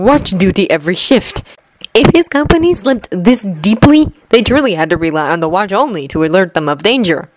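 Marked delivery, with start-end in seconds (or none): none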